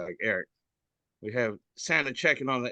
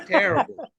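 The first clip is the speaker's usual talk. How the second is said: higher and louder exasperated TERRIBLE; mock disgust; 7 dB louder than usual. Real, not acted. Reacting to the meme, higher, louder disgust. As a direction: groan it like a scandalised viewer.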